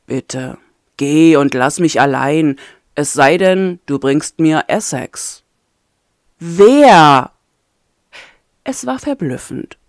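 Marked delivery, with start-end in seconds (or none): 0.1-5.4 low, firm, weary prisoner; a hint of a tired, stubborn captive; lightly voiced captive man. Ayem's voice held in story. 6.4-7.3 sharp, loud commanding question; imperious. Acted. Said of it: "Doch genau so klang sie gerade".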